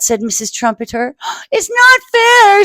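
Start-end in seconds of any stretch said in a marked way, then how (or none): 1.5-2.6 put-on high, loud, slow childish outburst; tearful rage. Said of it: "tears of rage".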